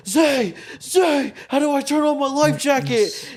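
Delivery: high, loud, panicked friend; panicky high kid voice. Retold or imitated. Scared little brother yelling from the water, panicked and high.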